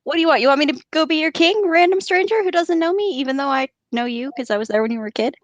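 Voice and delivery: high, mock-outraged disbelief; incredulous Boromir. Boromir mocking Aragorn's claim, high and incredulous. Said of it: "He really shouldn't have led with that".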